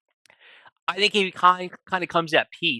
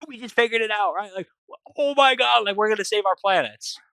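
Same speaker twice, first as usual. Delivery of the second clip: excited discovery; 7 semitones higher than usual. Retold or imitated. They solve the mystery.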